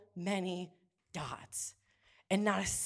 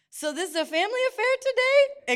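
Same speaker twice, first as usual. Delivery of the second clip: much higher, louder sing-song quip; mock high voice; 7 dB louder than usual. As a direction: squeak it in a teasing falsetto. Coworker's amused quip, mimicked.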